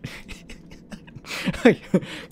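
wheezy, voiceless laughing fit; helpless airy laugh; laughing at oneself. Real, not acted. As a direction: crack up at your own joke. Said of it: "I make myself laugh".

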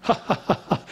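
mock high, breathy little laugh, half unvoiced; a hammed-up strained chuckle; imitating a laugh. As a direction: do the climber's tight, held-in laugh. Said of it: "he'd go".

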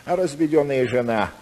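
ordinary talk

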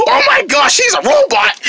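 high, panicked squeal; shriek, then stammer the alarm; terrified. Acted. Rabbit realizing the pig wants her, terrified.

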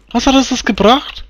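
incredulous high question. Real, not acted.

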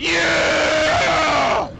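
loud triumphant yell; victory yell. Real, not acted. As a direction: celebrate the kill. Victory after killing mimics.

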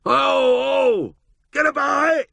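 very high, loud drover's holler; long high call, then a short one; distant herding cries. A narrator's reading. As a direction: holler as if across the hills. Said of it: "listening to the distant".

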